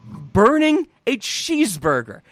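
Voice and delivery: ordinary talk, nothing marked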